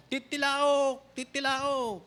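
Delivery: high mock rooster crowing. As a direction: crow it out like a rooster, pitched high. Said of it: "yung manok agad ang unang gumising".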